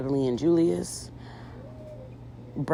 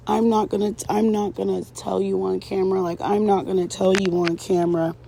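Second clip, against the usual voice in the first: high, mock upset voice; imitated tearful refusal; 6 semitones higher, 4 dB louder than usual. Girlfriend refusing to tell him on camera, imitated upset. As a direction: refuse in a raised, put-on tearful pitch. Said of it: "starts crying".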